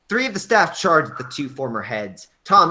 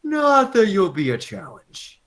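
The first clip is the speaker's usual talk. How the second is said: smirking confidence; 9 semitones higher, 4 dB louder than usual. Acted. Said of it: "Tom smirked with confidence".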